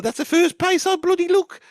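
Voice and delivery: loud, high, exasperated insistence; indignant outburst. Retold or imitated. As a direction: shout it like it's obvious. Heated mock argument with Geezer.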